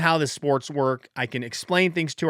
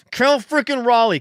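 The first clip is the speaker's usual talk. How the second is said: louder, higher shout; excited hype; 9 semitones higher, 6 dB louder than usual. Real, not acted. Hyping the hitter.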